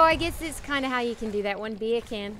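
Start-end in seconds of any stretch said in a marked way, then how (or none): 1.7-2.4 Australian accent, "Beer can" with the R dropped. Shown as a demonstration. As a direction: drop the R in "Beer can" like an Aussie. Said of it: "You kinda drop the R".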